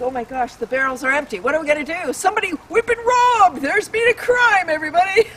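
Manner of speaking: rising to a high, loud hammed-up alarm; hammed-up cry of robbery; mock panic. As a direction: play the robbed syrup makers in panic. Acted panic of robbed sellers.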